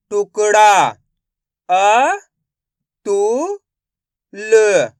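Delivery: loud separate syllables, then blend the word; syllable by syllable phonics. Shown as a demonstration. Teacher sounds out syllables loudly for the class. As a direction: sound out each syllable for young learners.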